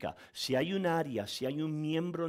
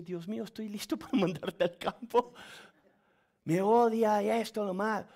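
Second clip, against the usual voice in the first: higher, laughing disbelief; laughing inner thought; 7 semitones higher, 4 dB louder than usual. Retold or imitated. Pastor thinking while being yelled at, laughing disbelief. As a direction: chuckle it out, half joking. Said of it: "yo pensé".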